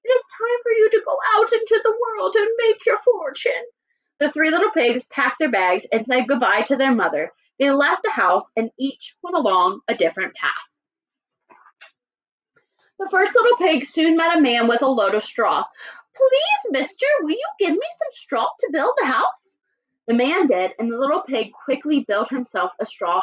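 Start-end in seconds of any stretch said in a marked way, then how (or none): 0.0-3.6 high-pitched storybook mother; mother pig. Acted. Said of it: "One day she said to them". 16.1-19.5 high little piggy voice; pleading little pig. Acted. Little pig begs for straw.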